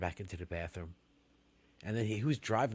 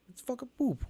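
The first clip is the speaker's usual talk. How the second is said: mock outrage; 4 dB louder than usual. Real, not acted. Ranting about wiping.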